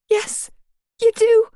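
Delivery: high, loud snap; snapping. Acted. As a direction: snap back hard. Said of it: "I snapped".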